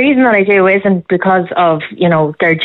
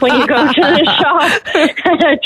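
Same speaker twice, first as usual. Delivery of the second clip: slow, high, laughing through the words; 8 semitones higher, much slower than usual. Real, not acted. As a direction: let the laugh spill into the question. Laughs at own joke.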